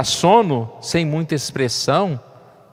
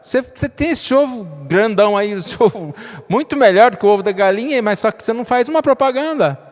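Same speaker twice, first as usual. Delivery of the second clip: louder, higher pushy urging; urging advice; 6 semitones higher, 4 dB louder than usual. Retold or imitated. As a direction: push the duck to advertise. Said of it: "alguém falou assim".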